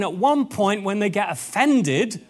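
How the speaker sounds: ordinary talk, nothing marked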